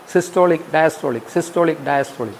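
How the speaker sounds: alternating like a heartbeat, fading away; heartbeat rhythm. Shown as a demonstration. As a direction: let the words pulse like a heart, trailing off. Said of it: "corresponds to the beat of the human heart".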